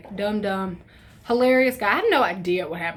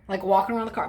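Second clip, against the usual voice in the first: ordinary talk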